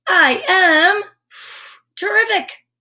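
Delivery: high, bright, proud declaration; excited affirmation. Shown as a demonstration. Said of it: "Try that one".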